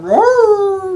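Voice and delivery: very high, loud playful roar; imitating a hound roaring up a tree; mock dog roar. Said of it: "the dogs are down below going".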